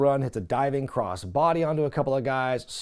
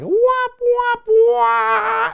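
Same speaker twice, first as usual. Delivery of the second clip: much higher, loud sung wop wop wah; sad trombone; 8 dB louder, much slower than usual. Retold or imitated. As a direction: sing the sad-trombone fail sting. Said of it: "you might as well have had a".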